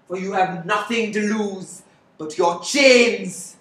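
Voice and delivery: loud rallying cry to the workers; fiery revolutionary speech. Acted.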